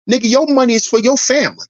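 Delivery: normal talking voice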